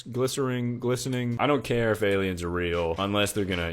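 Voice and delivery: normal talking voice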